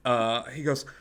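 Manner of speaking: normal talking voice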